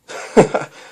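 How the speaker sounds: loud airy laugh; hearty laugh. Acted. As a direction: laugh off her fear. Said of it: "the Doctor laughed".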